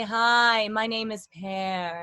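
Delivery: loud, drawn-out sung-like greeting; imitating an over-resonant singing teacher; Pear. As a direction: introduce yourself like a resonant voice coach. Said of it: "just like you'd imagine a singing teacher talking".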